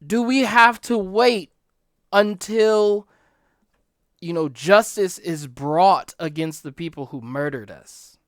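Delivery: loud, high crying out to God; martyrs' loud lament; anguished plea. Retold or imitated. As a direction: beg for justice with rising urgency. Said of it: "They go to the Lord and they say".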